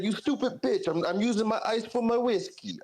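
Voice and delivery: high, mock macho snap; mocking his friend. Retelling the friend insulting his girlfriend over ice, high mock voice. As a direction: play the friend's put-down pitched high. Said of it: "he's like".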